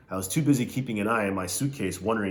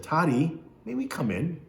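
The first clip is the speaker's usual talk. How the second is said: sweet mother; 8 semitones higher than usual. Acted. Mother coos at her son.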